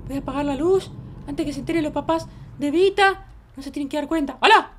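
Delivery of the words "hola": sudden loud hello; shouting. Pretend. Child yells out by Vita's house at night, shouting. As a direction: shout to be heard. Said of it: "no voy a gritar tanto".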